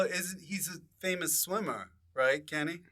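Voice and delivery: ordinary delivery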